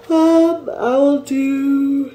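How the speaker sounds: talking through a yawn; words stretched by a big yawn. Real, not acted. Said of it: "pardon me for all the yawning".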